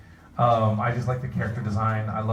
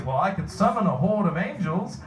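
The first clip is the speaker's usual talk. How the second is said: higher, theatrical mock British; bad British accent; 8 semitones higher than usual. Fan quoting the other sketch character, bad British accent. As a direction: answer in a hammed-up fake British voice. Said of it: "bad British accent".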